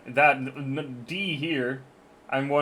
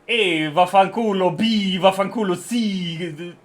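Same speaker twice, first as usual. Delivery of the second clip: put-on Italian accent; 2 semitones higher, 4 dB louder, slower than usual. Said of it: "There will be an Italian accent".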